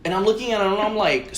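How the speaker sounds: normal talking voice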